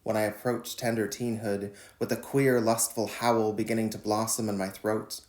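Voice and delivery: measured, deliberate poem reading; poetry reading. Acted. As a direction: read each line like a spoken poem. Reads his poem Howl.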